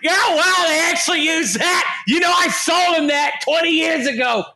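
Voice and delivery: hammed-up high-pitched crowing; gloating film distributor. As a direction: put on a high, disbelieving shout. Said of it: "I can hear the asylum going".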